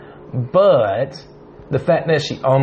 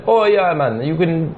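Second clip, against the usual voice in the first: mock Jamaican street vendor, higher; Jamaican accent; 5 semitones higher than usual. Imitating a New Orleans ticket tout in a Jamaican accent. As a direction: hustle tickets in a light Jamaican lilt. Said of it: "I don't know why he's Jamaican".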